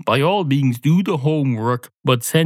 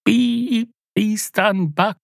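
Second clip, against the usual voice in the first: high beeping, announcement voice; kid's bus announcement; 9 semitones higher, slower than usual. Pretend. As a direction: play the bus, beep beep. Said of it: "imaginative kids will at least go".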